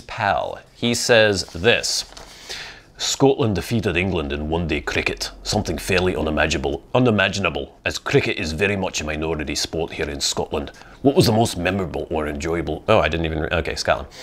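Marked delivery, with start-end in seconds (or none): 3.0-10.7 a self-admittedly bad Scottish accent, lightly done; horrible Scottish accent. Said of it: "I'm doing a horrible accent right now". 11.0-12.8 a faint, bad Scottish accent on "What was the most memorable or enjoyable"; horrible Scottish accent. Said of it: "I'm doing a horrible accent right now".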